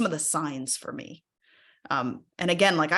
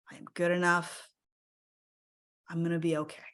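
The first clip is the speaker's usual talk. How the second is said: slow self-soothing affirmations. Shown as a demonstration. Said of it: "I just tell myself".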